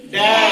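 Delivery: डैड called out loudly as a model; teacher calling डैड out loud to the class; loud model word. Shown as a demonstration. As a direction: belt out डैड for the class to copy. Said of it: "एडी का उच्चारण हम कैसे करेंगे".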